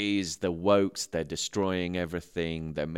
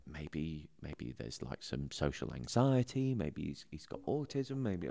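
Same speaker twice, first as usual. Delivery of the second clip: quiet inner thought; 2 semitones lower, 7 dB quieter than usual. Retold or imitated.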